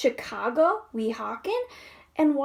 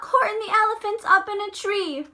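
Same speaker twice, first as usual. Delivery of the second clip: mocking taunt; 6 semitones higher, 4 dB louder, faster than usual. Mocking animals taunt Horton.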